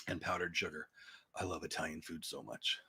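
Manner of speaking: a hint of a muffled villain rumble; Bane voice. Real, not acted. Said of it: "Did I sound like Bane right there?".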